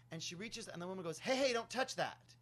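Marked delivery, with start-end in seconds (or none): 1.2-2.4 high, loud retold protest; sharp warning. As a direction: mimic a woman warning someone off, raised voice. Woman stops hand reaching for boombox.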